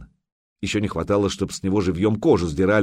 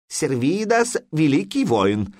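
high, slow, stiff translator voice; pompous foreign interpreter; interpreter's voice; 7 semitones higher, slower than usual. A narrator's reading. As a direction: spell it out like a clumsy interpreter. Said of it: "донёс мысль переводчик".

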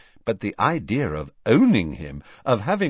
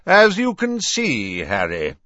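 high, loud opening that settles quickly; raised voice; 5 semitones higher, 4 dB louder than usual. A narrator's reading. Headmaster talking over the elf's croaks, loudly. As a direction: talk loudly over a din. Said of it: "said Dumbledore loudly".

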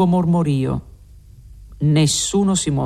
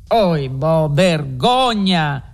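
a slow, drawn-out cry of shame; indignant outburst; much slower than usual. A narrator's reading. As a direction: Renzo recoils in horror and protests. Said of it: "scappò fuori Renzo". (sdegno trattenuto, lento)

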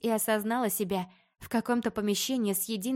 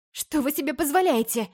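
higher, louder indignant cry; 6 semitones higher, 5 dB louder than usual. A narrator's reading. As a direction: cry it out in outrage. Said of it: "вскричала я".

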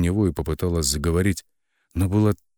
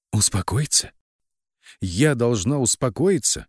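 higher, incredulous exclamation; mildly raised, aghast questioning; horrified cry; 5 semitones higher than usual. A narrator's reading. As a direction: exclaim in horror, pitch climbing. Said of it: "Вскричала с ужасом".

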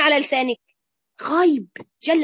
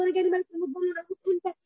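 mock tearful, higher and softer voice; imitated tearful pleading; 5 semitones higher, 5 dB quieter, much faster than usual. Frightened man tells Ibn Sirin his dream, lightly imitated weeping. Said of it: "لما جاله واحد يبكي، قعد يعيط".

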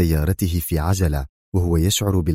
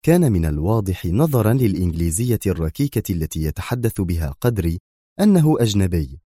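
ordinary delivery, nothing marked

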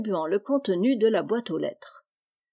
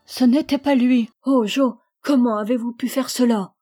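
louder, raised voice, wounded accusation; distraught reproach; 3 semitones higher, 6 dB louder than usual. A narrator's reading. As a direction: cry out in hurt disbelief. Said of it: "pleura comme si son cœur allait se briser". (reproche bouleversé)

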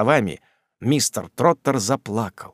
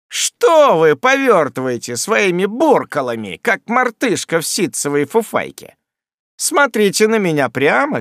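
menacing; 5 semitones higher, 3 dB louder than usual. A narrator's reading. Sam threatens Job.

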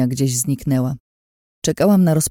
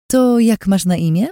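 higher, louder sugary question; sweetest voice; 5 semitones higher, 5 dB louder than usual. Acted. Lin asking the waitress's name in her sweetest voice. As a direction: ask sweetly, like a honeyed trap. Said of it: "najsłodszym ze swoich głosów".

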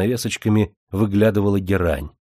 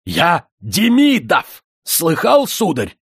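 much higher, louder, slow bellow; furious shout; 9 semitones higher, 7 dB louder, slower than usual. A narrator's reading. Offended rich man roars his name at the old father, furious bellow. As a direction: bellow your own name proudly.

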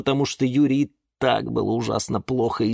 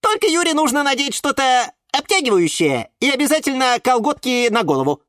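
high, eager little voice; squeaky violet; 4 dB louder than usual. A narrator's reading. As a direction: squeak out a know-it-all tip. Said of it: "пискнула фиалка Наталка". (тонкий писклявый голосок)

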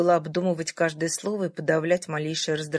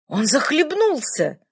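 higher, louder horrified exclamation; horrified cry; 8 semitones higher, 6 dB louder, slower than usual. A narrator's reading. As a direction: blurt it out in sudden horror. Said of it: "вдруг в ужасе воскликнул старик". (в ужасе восклицая)

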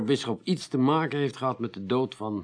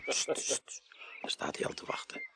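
hushed whisper to a colleague; st, a conspiratorial whisper; whispered aside; 11 dB quieter than usual. Acted. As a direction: whisper so he cannot hear. Detective whispers aside as priest waits.

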